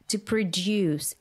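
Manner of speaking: "produce" stressed on DUCE; second-syllable stress. Shown as a demonstration. Teaching the verb to learners, second-syllable stress. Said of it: "stress in the second syllable".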